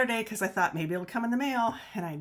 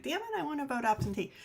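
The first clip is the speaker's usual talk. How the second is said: frustrated whine; 8 semitones higher, faster than usual. Retold or imitated. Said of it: "and I was like".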